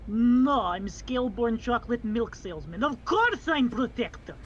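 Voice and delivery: put-on accented protector, indignant sarcasm; sarcastic protector. Player plays sarcastic protector.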